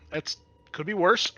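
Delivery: usual speaking voice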